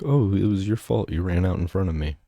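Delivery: ordinary delivery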